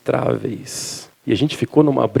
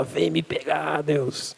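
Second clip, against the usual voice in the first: slow, much softer mock defiance; defiant Lieutenant Dan; 3 semitones higher, 9 dB quieter, much slower than usual. Pastor quotes Lieutenant Dan daring God, slow and much softer. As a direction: dare God softly from the mast. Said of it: "o Tenente Dan senta lá em cima e fala".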